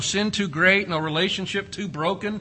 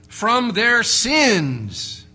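slow emphatic climax; 7 semitones higher, 7 dB louder, much slower than usual. Acted. Climactic preaching line.

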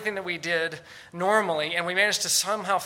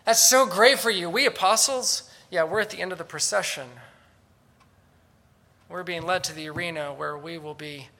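sarcastic; 4 dB louder than usual. Retold or imitated. Paul contrasting apostles with proud Corinthians, sarcastic. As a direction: congratulate them with a sneer. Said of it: "And Paul says".

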